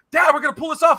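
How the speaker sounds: high, loud burst of hopeful excitement; excited kid. Retold or imitated. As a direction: imitate an over-excited six-year-old. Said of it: "Tristan got so excited".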